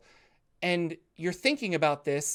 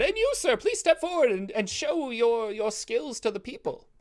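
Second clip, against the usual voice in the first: put-on old priest; 4 semitones higher than usual. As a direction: bless the crowd like an ancient holy man. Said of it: "priest points at you".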